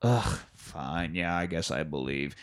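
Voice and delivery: low, soft, reluctant grumble; mock grudging assent. Last holdout agreeing to believe, low grudging mumble. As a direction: give in with a low groan.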